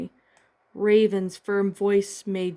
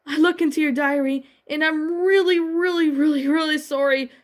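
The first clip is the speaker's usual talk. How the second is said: higher and louder, begging for forgiveness; pleading; 7 semitones higher, 4 dB louder than usual. A narrator's reading. Starfire begging Raven to forgive her, higher pleading. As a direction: plead with each really pushing higher. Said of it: "Starfire pleaded".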